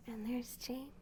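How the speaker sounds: whisper. Acted. Hushed aside showing picture.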